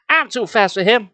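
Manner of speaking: boastful. A narrator's reading. Superhero boasts to the kid about his speed, cocky. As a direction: puff up and brag. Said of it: "the superhero boasted".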